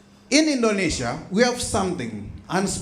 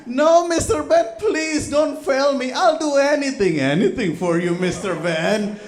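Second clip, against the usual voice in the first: pleading student; 7 semitones higher, 3 dB louder than usual. Acted. Student begging again, acted.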